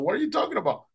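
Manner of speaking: high, incredulous question; retold bewilderment. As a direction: answer the excited alert in confusion. Swimmer puzzled by alert.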